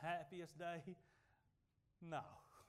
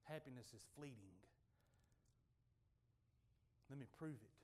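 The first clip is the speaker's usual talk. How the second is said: hushed; 11 semitones lower, 19 dB quieter than usual. Acted. Quiet storytelling turn.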